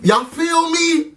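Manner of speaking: high, slow appeal; drawn-out plea to viewers; pleading. Real, not acted. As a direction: drag it out begging agreement. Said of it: "appeal to viewers".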